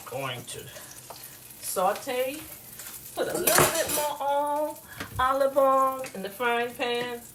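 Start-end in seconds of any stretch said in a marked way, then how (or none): none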